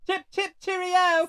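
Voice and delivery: very high, loud cartoon British caricature; over-the-top British chirp; mock British. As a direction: drag it out in a squeaky, hammed-up English accent. Mimicking the incomprehensible English man.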